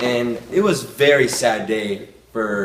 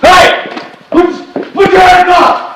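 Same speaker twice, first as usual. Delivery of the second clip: very high, loud yell; shouted command; 14 dB louder than usual. Acted. British soldier confronting Americans, shouted command. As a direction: yell it out as an urgent order.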